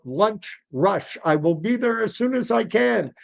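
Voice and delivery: high-pitched exasperated protest. Acted. Deli owner protesting in lunch rush, mildly exasperated. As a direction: plead back, a touch harried.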